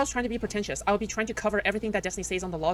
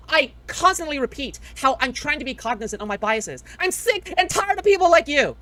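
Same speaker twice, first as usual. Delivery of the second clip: raised, higher, heated complaint at a critic; heated rant; 5 semitones higher, 4 dB louder than usual. Real, not acted. Streamer ranting at a chat critic, angry and raised. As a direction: vent your anger at the chatter.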